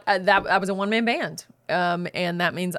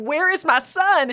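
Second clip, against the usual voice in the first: high, slow, loud mock-frantic cry; imitated panic; 9 semitones higher, 5 dB louder, slower than usual. Daughter imitates mom in pure panic, frantic cry. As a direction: wail it high and drawn out, imitating a frantic mother. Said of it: "she was in a pure panic".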